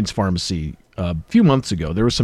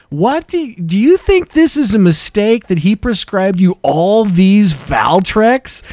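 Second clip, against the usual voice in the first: loud, high, slow pharmacy clerk announcement; retold public shout, stretched out and raised; shouting across room; 7 semitones higher, 5 dB louder, slower than usual. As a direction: imitate a clerk broadcasting it to the whole waiting room. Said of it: "pretty much shouting across the room".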